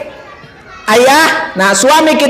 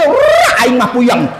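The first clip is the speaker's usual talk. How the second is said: ordinary talk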